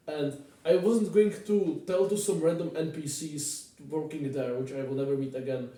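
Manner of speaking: ordinary talk, nothing marked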